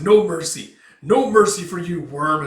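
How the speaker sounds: loud, merciless threat; forceful roar at the enemy; battle shout. Retold or imitated. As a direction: bellow it while charging. Acts warrior's shout while charging.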